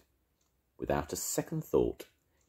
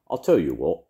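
confident caterpillar; 8 dB louder than usual. Acted. Caterpillar Arthur speaks.

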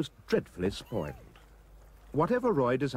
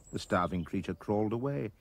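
usual speaking voice

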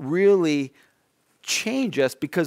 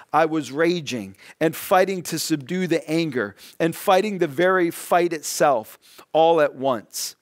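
normal talking voice

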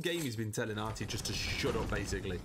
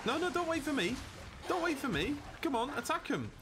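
very high-pitched, taunting urging; mocking send-off; 13 semitones higher than usual. Real, not acted. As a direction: goad him on, pitched way up. Mockingly sends Atreus off to fight.

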